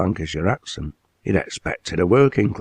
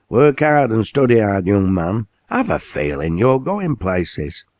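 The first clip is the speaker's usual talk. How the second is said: encouraging mill owner; 4 semitones higher than usual. Acted. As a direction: clap the lad on the back with your voice.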